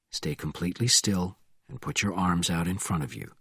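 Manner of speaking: low, soft, controlled order; quiet command. A narrator's reading. Bourne holding up the driver, low quiet command. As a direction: order them softly, with cold control.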